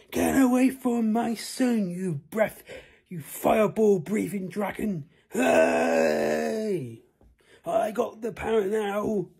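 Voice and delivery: high, loud battle cry at the dragon; father shouts at the dragon; heroic shout. Acted. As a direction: yell it defiantly at the beast.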